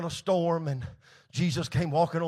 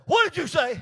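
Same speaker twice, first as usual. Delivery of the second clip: higher-pitched, sharp parental challenge; challenging parent; 8 semitones higher, 5 dB louder than usual. Retold or imitated. Parents calling up to their muttering boy, challenging. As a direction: play the parent who overheard something.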